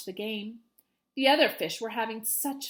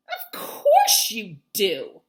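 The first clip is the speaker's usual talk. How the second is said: slow, louder sneering fish; haughty reply; 3 semitones lower, 8 dB louder, much slower than usual. Acted. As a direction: drag it out with scorn. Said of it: "said the fish with the jagged fins".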